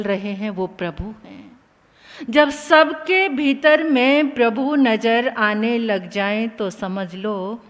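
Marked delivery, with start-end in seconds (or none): none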